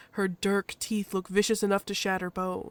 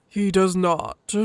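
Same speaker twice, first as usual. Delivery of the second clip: slow, louder, weighty denial; gruff husky Loken; 6 dB louder, much slower than usual. Acted. Loken answers the badger, gruff and firm. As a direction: answer slowly and firmly.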